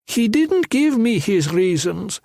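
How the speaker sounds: mock the boss's silence; sarcastic shrill thug. A narrator's reading. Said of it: "Shrill voice said sarcastically".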